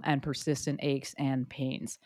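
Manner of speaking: ordinary talk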